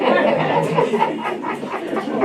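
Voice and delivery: a long unvoiced chuckle; soft breathy laugh. Real, not acted. Mother laughing as she recalls the worried neighbours, soft breathy chuckle. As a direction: laugh quietly under the breath. Laughs while telling the story.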